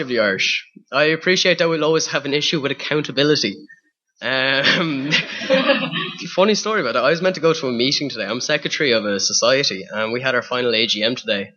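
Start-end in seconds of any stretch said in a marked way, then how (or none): 5.2-6.3 breathless giggle. Real, not acted. Laughing before funny story.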